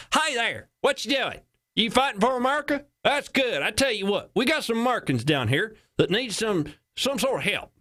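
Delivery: high-pitched Texas twang; Texas accent. Acted. Texas caller phones the army base, hammed-up Texas accent. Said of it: "This is Texas accent, by the way".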